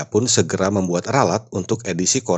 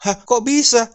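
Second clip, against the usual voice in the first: high, louder disbelieving question; astonished; 4 dB louder than usual. Acted. Villager questioning the kiai's answer, astonished. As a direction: react in high-pitched disbelief.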